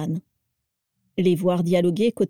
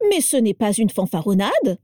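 ordinary delivery, nothing marked

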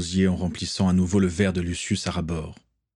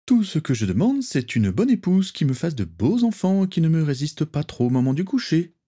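lively, raised pitch declaration; exclaiming; 8 semitones higher than usual. A narrator's reading. Nott to his friends, eagerly exclaiming. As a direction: blurt it out eagerly. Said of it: "s'exclama-t-il".

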